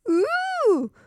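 high startled ooh; a sudden frightened little cry; a frightened little princess hears a noise in the dark; surprised ooh. A narrator's reading. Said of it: "cried the little princess".

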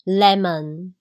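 lemon stressed on the first syllable; model pronunciation. Shown as a demonstration. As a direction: say "lemon" for repeating, ending in "mun". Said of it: "来，最后一起". (带读lemon，重音在前，字尾读“猛”)

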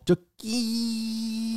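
high squeaky scrape sound; imitated scraping squeal; scrape noise. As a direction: mimic the bike scraping the door. Sound of bike scraping car.

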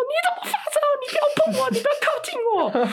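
very high mock shriek of disgust; panicked shriek. Sister shrieking at her feverish brother to stay away, panicked squeal. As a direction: squeal at him to keep away. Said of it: "我就说".

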